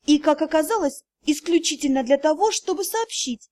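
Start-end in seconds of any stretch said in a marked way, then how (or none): none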